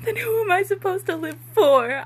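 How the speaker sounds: a touch of hammed-up crying; sobbing. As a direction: melodramatic sobbed question. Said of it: "I sob".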